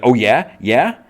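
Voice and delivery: loud, high, rising retort; acted confrontational comeback; combative challenge. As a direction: dare him to go on. She challenges the psychologist.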